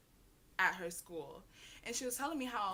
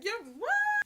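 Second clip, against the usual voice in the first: high, loud disbelieving squeal; shocked; 13 semitones higher, 7 dB louder than usual. Retold or imitated. Friend hears the news, squealing in shock.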